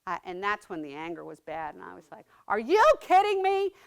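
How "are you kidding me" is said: high, loud, angry disbelief; loud, high angry cry; furious outburst. Retold or imitated. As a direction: snap it out in outrage. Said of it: "I was so mad".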